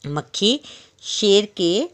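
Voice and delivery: ordinary delivery, nothing marked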